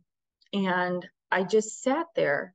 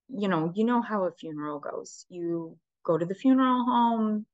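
ordinary talk, nothing marked

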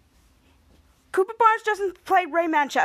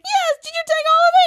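much higher and louder put-on girl voice; high character voice; 14 semitones higher, 6 dB louder than usual. Retelling Mika's discovery, high louder put-on voice. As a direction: squeak it out as the upset girl. Voicing Mika accusing Ray.

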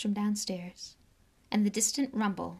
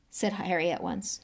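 ordinary delivery

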